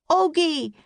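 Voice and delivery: high loud yell. A narrator's reading. Jack yelling for the lost puppy. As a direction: yell the dog's name. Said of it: "yelled Jack".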